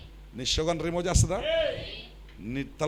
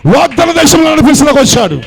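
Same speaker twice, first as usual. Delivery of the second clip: rising to a loud shout, higher; preaching shout; 10 semitones higher, 18 dB louder than usual. Real, not acted. Preacher to congregation, a loud climactic shout. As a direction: proclaim the promise at the top of the voice.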